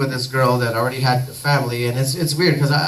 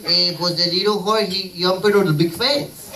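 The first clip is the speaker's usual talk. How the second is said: put-on thick Mexican accent; 3 semitones higher than usual. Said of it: "Mexicans with this thick accent".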